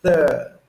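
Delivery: "thir-" as an open "thuh", R dropped; British "thir-" with no R. Shown as a demonstration. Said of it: "there's no R practically".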